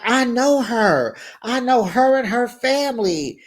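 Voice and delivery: pointed claim. Retold or imitated. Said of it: "She like".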